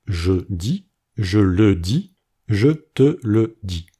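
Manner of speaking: "je dis", "je le dis", "je te le dis" with each E fully sounded; slow and careful, pronounce every E in "je te le dis"; formal French, every E said. Shown as a demonstration. Teacher showing formal French, every E pronounced slowly and clearly. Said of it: "Tous les E dans ces phrases sont prononcés".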